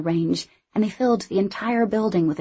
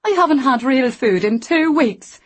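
wailing; 7 semitones higher, 6 dB louder than usual. Acted. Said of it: "she wailed".

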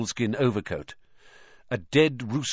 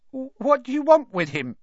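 quavering voice; 5 dB louder than usual. A narrator's reading. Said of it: "in a quavering voice".

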